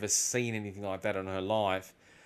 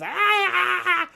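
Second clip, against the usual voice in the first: high laughing shrieks, much higher and louder; mock excited shrieks; 7 dB louder, much slower than usual. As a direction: screech like a girlfriend who thinks she is being proposed to. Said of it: "she says".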